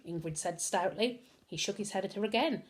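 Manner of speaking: plain reading voice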